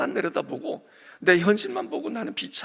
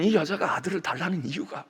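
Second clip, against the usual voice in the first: normal talking voice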